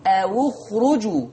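slow أو اخرجوا with the waw rounded "awu"; أو اخرجوا بضم الواو; stressed damma on waw. Shown as a demonstration. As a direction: stretch the u of أو. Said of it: "سامعين الضم؟".

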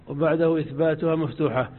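ordinary talk

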